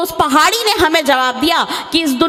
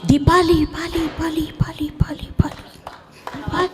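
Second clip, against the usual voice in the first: mock echo; 5 dB quieter, slower than usual. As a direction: echo fading away to a whisper. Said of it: "पलट कर आवाज़ आती है". (पहाड़ी की गूंज, धीरे-धीरे फुसफुसाहट में खोती हुई)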